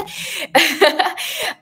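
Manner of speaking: light high laugh after a joke; a quick amused laugh; short laugh. Real, not acted. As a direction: laugh at the guest not understanding Serbian. Laughs at guest not understanding.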